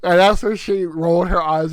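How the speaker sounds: ordinary delivery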